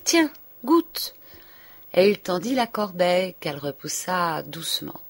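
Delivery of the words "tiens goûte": offering eagerly. A narrator's reading. Charles offering apricots.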